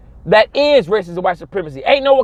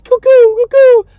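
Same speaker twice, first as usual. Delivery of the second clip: shrill-free high, loud sing-song cuckoo, cuckoo; mock the little rockets with a cuckoo call; 8 dB louder, slower than usual. Mocking the weak weapons Europe gives Africa, high cuckoo call.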